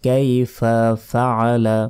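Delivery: কাইফা ফাআলা said slowly, ain from the throat; slow correct কাইফা ফাআলা; slow pronunciation. Shown as a demonstration. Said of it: "আইনের উচ্চারণ গলার ভেতর থেকে হবে".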